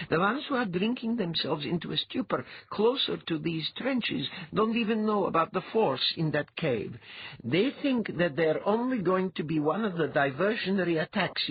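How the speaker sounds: high-pitched woman's character voice; mind-reader giving a report. Acted. She answers Althalas about the enemy cave, reporting.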